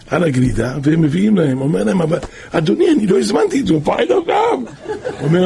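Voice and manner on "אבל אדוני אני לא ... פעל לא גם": rising much higher, startled objection; the father protests he ordered nothing, surprised and higher. Retold or imitated. Father protests unordered dessert.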